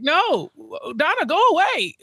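high, fed-up go away; mock exasperated Harvey. Harvey shooing Donna away, high and fed up. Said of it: "I was like".

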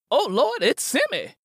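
high, loud frightened cry; terrified Willie. Acted. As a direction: Willie sees Simi at the door.

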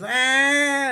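high, loud bawling "ah" of a kid, imitated; a tantrum scream in the grocery store; child's tantrum wail. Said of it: "crying and throwing a temper tantrum".

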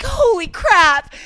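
high, loud, drawn-out amazement; re-enacted squeal of shock; amazed outburst. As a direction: stretch it out in thrilled disbelief. Re-enacting her amazed reaction.

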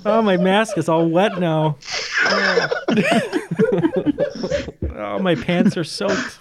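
high, giggling, out of control; laughing through words. Real, not acted. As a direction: talk through a laughing fit. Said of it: "We got the giggles".

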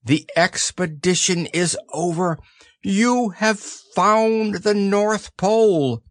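high, slow proclamation; solemn announcement. A narrator's reading. Christopher Robin declares the expedition over, solemn. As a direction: proclaim it grandly, drawing out the words. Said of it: "said Christopher Robin solemnly".

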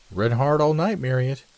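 lightly voiced fellow student; casual student greeting. A narrator's reading. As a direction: breezily hail your study mate. Student greets his friend.

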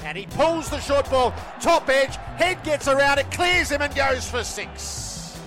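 cricket commentator. Acted. Radio call of a six.

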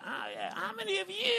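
high rock star screech; [name] voice. Pretend. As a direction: scream it like a stadium rock singer. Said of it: "a moment of chaos as [name]".

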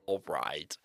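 unvoiced breathy whisper. Real, not acted. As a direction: whisper it under your breath. Whispered reaction.

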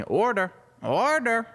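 high, slow sing-out of "order"; assistant calling. Acted. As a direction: call out to a daydreaming boss. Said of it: "the sound of his assistant".

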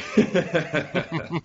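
high, hoarse laugh through a cold; absurd congested laugh. Real, not acted. Host amused by his co-host's teasing, absurd congested laugh. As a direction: laugh helplessly with gunk on the cords. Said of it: "my laugh sounds absurd right now".

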